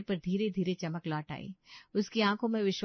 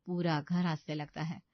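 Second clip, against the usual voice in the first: plain reading voice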